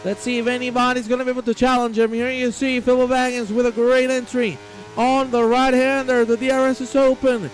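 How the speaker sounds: excited finish-line call; live race commentary. Acted. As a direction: race announcer building in pitch toward the finish.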